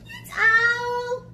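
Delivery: high little mouse voice; cheery mouse. Acted. Mouse telling Gruffalo who hooted, high and light. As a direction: chirp it in a squeaky voice.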